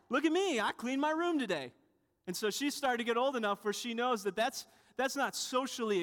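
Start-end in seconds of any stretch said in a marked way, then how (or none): 0.1-1.7 high, mock bragging child; hammed-up boast, pitched way up; boastful. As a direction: imitate a kid proudly showing off. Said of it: "it would be boastful to just say".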